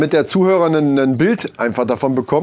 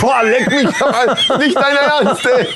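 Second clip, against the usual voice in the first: high, laughing exasperated outburst; outraged disbelief; 9 semitones higher than usual. Real, not acted. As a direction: protest in mock despair, laughing. Shocked at the hard location.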